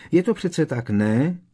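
high, loud pressing question; insistent. A narrator's reading. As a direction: badger them for a yes. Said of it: "dorážel na něj Harry".

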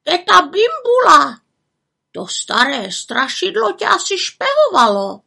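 exclaiming raven. A narrator's reading. Havran warns the little witch of a spying aunt, exclaiming. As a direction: draw it out high like an alarmed bird. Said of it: "zvolal Havran".

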